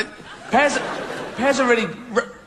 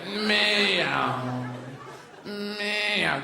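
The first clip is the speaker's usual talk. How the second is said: passing cat yowl, falling; mock meow changing pitch. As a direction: meow sliding down like the Doppler effect. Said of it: "change in notes of cats".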